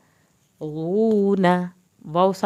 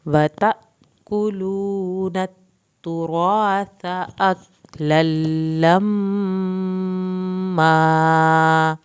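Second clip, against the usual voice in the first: slow, louder, drawn-out melodic tajweed recitation; Quran recitation; 6 dB louder, much slower than usual. Shown as a demonstration. Teacher reciting the full verse for students, slow melodic recitation. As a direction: chant it out full and slow, stretching the long vowels.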